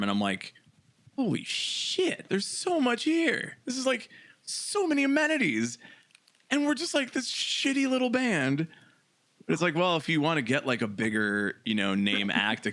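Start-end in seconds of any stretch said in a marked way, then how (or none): 1.2-8.7 awed excitement. Retold or imitated. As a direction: gush over the green room, wide-eyed. Acting out awed green room reaction.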